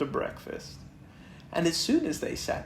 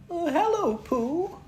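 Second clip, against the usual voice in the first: much higher, small timid Piglet. Acted. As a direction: squeak it like a tiny nervous pig. Piglet voiced in story.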